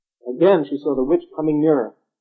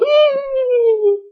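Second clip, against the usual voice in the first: witch cackle; 6 dB louder than usual. A narrator's reading. Witch cackles after her demands.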